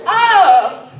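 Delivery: loud, high cry of shock; startled "oh". Retold or imitated. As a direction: cry out as if the show just shocked you. Startled reaction to TV show.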